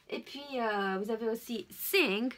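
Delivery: usual speaking voice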